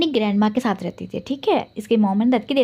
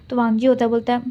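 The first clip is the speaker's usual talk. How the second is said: ordinary delivery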